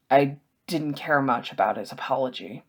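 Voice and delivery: plain reading voice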